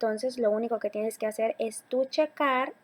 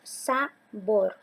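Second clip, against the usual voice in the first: "sabor" broken as sa... BOR; teacher's slow syllable split of "sabor". Shown as a demonstration. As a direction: say "sabor" in two separate beats, sa and bor. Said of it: "la dividimos en sílabas".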